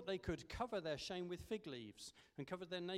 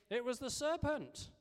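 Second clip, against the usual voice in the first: high, louder imitation of shifting blame; mock blaming; 9 semitones higher, 4 dB louder than usual. Preacher voices the woman blaming the serpent, mock protesting. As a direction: protest it was someone else's fault, hammed up. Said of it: "began the blame game".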